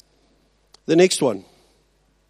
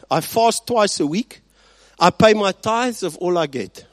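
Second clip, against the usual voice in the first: loud, preening list of his own virtues; boastful Pharisee; 4 semitones higher, 6 dB louder than usual. Acted. Preacher acting the Pharisee boasting before God, loud and smug. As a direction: brag to God about how good you are. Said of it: "he says that this guy is praying and he says".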